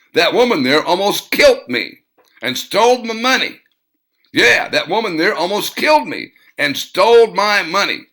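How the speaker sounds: loud, high shrieking complaint; screaming accusation. A narrator's reading. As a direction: scream it as an outraged victim. Blonde screaming to her husband.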